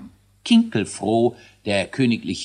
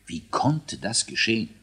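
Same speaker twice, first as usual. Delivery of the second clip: plain reading voice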